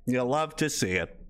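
normal talking voice

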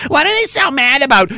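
high, whiny mock question; put-on innocent whine; mock bafflement. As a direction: play dumb in a high mocking voice. Mimicking the baffled targets.

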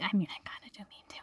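hushed, whispery admission; sneaky under-the-breath confession; confessional aside. Real, not acted. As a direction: drop to a quick whisper, owning up. Confessing aside after denial.

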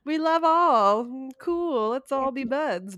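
loud, high, drawn-out cheer; mock-friendly Taliban. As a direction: call it out slowly, high and over-friendly. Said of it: "will be like".

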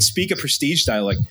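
normal talking voice

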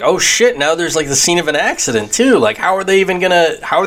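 high startled realization; alarmed reaction. Retold or imitated. Viewer shocked by the car crash, high alarm. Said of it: "I was like".

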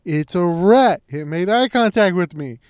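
loud, emphatic mock yell; outraged insistence. Sister insisting the rat saw her, mock outraged yell. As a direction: punch it out like an imitated rant.

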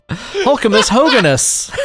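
high, slow incantation; drawn-out joke incantation; mock spell casting. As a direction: pronounce it like a wizard's spell. Acting out a miscast spell.

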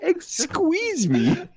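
mocking. Real, not acted. Mocking the saying.

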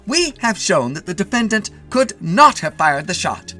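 high, loud courtroom oratory; shouted defence. A narrator's reading. Defence attorney arguing for his client, shouting. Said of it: "shouted Mitty's attorney".

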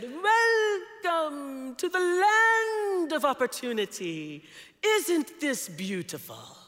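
put-on high, slow, loud South African accent; a tentative try at a South African accent, drawn out; attempted South African accent. Retelling Phineas's welcome in a South African accent. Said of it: "I can't really do a South African accent, but I'm gonna try".

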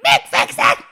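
high, loud re-enacted yell. Woman mid-contraction yelling at her partner, high loud command. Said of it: "Like, I'm yelling at him".

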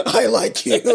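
hammed-up aggressive confession, laughing; mock-forceful, breaking into laughter. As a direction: imitate a friend's pushy drunken declaration. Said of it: "I can only imagine how aggressively you're saying this".

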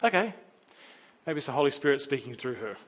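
normal talking voice

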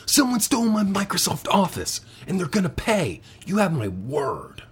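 intense movie-star threat, lightly voiced; half-failed dramatic vow; Nick Cage impression. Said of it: "I can't do Nick Cage".

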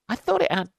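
high, quick mock girlfriend voice; puzzled girl. Girl asking about missing end bit, high and puzzled. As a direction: pitch it up high and fast, acting puzzled.

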